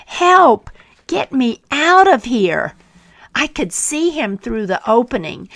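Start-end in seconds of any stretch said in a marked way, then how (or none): 0.1-2.8 high, loud cry for help; yelling for help. Acted. Trapped teacup yelling to the potter, loud and high. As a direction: shout it from inside the hot oven. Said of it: "I yelled".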